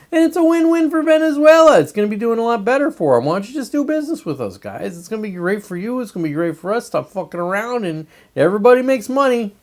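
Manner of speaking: high, bright-pushed persuader voice talking to Venezuela; mock sales pitch. Playing Trump coaxing Venezuela, mock persuasive pitch. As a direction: sell it like a smooth dealmaker.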